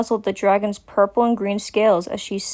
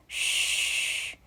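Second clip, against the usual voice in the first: soft hushing shh; gentle shush; soothing shush; 11 dB quieter than usual. A narrator's reading. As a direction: hush a crying child. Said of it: "tried to calm the drake's nerves".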